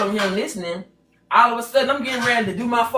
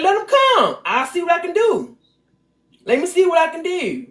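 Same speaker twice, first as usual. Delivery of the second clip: higher, daring retort; retold defiance; 5 semitones higher than usual. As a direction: throw down the challenge. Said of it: "So I said".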